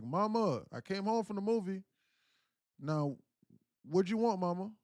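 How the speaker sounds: loud, high call on arriving home; calling out to Mama. Retold or imitated. Son back from the movie, calling to mother. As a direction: imitate a son hollering for his mother.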